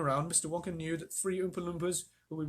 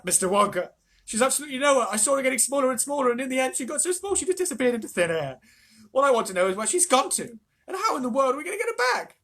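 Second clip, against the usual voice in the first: high, loud, fast at the end; frantic plea; 9 semitones higher, 8 dB louder than usual. A narrator's reading. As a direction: plead in a panic. Mrs Bucket pleading with Wonka.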